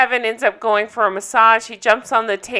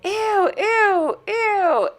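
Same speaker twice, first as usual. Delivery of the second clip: high squealing ew of disgust; 11 semitones higher, much slower than usual. Retold or imitated. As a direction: squeal it like a grossed-out girl. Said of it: "Kim starts saying".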